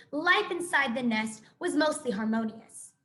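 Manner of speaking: ordinary delivery, nothing marked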